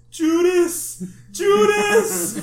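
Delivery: high, loud sing-song taunt; loud chanted retold taunt of a friend cutting the line; sing-song shouting. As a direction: taunt him from the back of the line.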